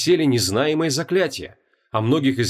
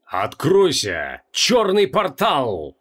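shouting a spell; 3 semitones higher, much slower than usual. Acted. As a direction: cry out the spell in triumph. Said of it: "и выкрикнул".

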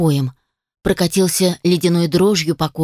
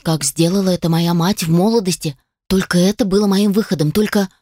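ordinary delivery

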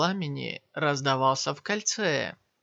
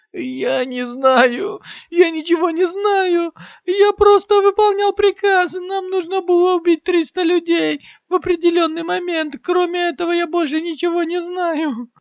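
very high, loud, terrified cry; fearful shout; 14 semitones higher, 8 dB louder than usual. Acted. Maimed warrior screams in terror at Shiyan. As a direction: beg for your life in panic. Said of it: "со страхом в голосе закричал".

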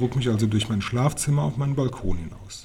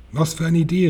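higher, louder cry of delight; excited exclamation; 6 semitones higher, 6 dB louder than usual. A narrator's reading. Grandmother delighted by the child's plan, exclaiming excitedly. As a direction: exclaim it, thrilled by the idea. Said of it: "rief sie".

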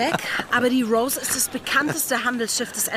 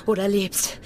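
ordinary delivery

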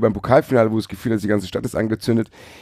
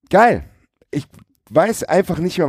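begeistert; 9 semitones higher than usual. Real, not acted. Emphatic praise of the Eintracht.

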